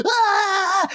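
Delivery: high, hammed-up panic scream; imitating a shriek; mock panicked scream. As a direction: scream like a terrified stewardess. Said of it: "comes running down the aisle going".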